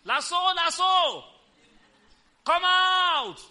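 loud, high commanding shout; Jesus calling Lazarus from the tomb, shouting; yelling. Acted. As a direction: call the dead man out of the grave. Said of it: "And Jesus yelled out".

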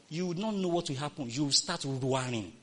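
ordinary delivery

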